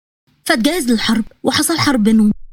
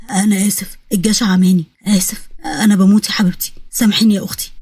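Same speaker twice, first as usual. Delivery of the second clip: low, weak apology; low deathbed plea; dying, remorseful brother; 5 semitones lower than usual. Acted. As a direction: beg your sister's forgiveness, pitched down. Narrator voices dying Iyad begging.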